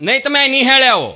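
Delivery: loud, high insistence; angry teacher insisting the father come. Retold or imitated. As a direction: demand he come to school.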